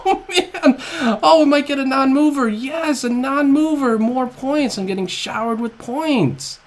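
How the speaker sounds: high, loud delighted cries; excited cheering. Real, not acted. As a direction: cheer over the points. Thrilled at bonus points.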